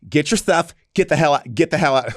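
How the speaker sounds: a hint of a sassy hotel manager. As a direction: throw them out with sass. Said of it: "This is how, how he sounds".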